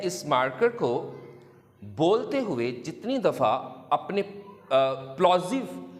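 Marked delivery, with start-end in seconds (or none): none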